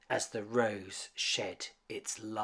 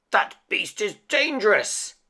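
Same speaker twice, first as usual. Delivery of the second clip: loud alarmed shout, higher; 9 semitones higher, 9 dB louder than usual. A narrator's reading. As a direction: yell the warning at the crowd. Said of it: "Gaston shouted".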